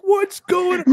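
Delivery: high-pitched comic panic; mock alarm. Patient seeing smoke come up mid-procedure, mock alarm. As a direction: squeal it like a startled patient.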